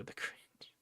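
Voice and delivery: hushed, breathy whisper. Real, not acted. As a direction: whisper it to chat. Whispered aside.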